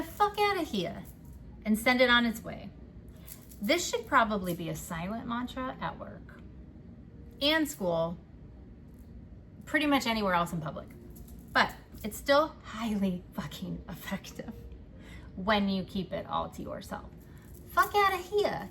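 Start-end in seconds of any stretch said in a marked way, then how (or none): 0.0-1.0 higher, punchy New Jersey accent dismissal. Shown as a demonstration. Reader shows the New Jersey mantra for bullshit, New Jersey accent. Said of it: "Say it in your New Jersey accent". 17.7-18.7 higher, emphatic New Jersey accent kiss-off. Shown as a demonstration. Reader repeats the mantra in New Jersey accent. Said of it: "Say it in your New Jersey accent".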